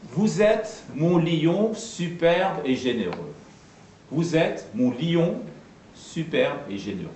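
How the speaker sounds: slow, measured declaiming of a verse line; stately, drawn-out theatre verse; verse recitation with diaeresis. Shown as a demonstration. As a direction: recite it as classical verse, slowly, "lion" as li-on. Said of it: "un exemple de diérèse".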